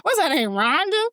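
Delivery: high squeaky mock question; high pitched teasing; mock incredulous. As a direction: squeak the name in mock shock. Repeats the tease.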